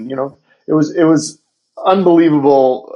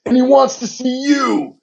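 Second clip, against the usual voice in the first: excited news; 11 semitones higher than usual. Retold or imitated. Assistant announcing big news.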